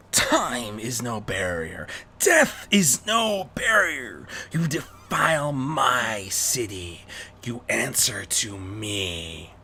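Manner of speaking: slow, otherworldly demon voice; Rarity as a demonic bat threatening the criminals; demonic twist. Acted. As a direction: intone it slowly like a menacing demon. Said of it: "a sort of demonic twist".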